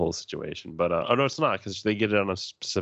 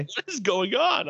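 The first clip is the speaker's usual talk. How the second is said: much higher, slow, amazed; excited; slower than usual. Retold or imitated. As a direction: blurt it out in awe. Retelling his excited reaction.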